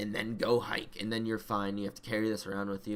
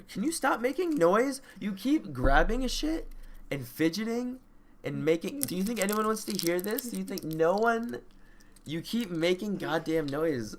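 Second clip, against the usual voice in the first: annoyed scolding; 7 semitones higher than usual. Real, not acted. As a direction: snap at her to stop the noise. Scolding girlfriend for fidgeting.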